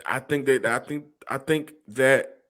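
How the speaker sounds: stammering, stuck on the words; hesitant stutter, restarting. Real, not acted. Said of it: "look at him how he's stuttering".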